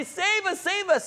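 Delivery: high-pitched imitated plea for rescue; crying out. Frightened believers pleading with Jesus, crying out. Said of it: "we cry out and say".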